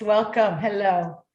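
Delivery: ordinary talk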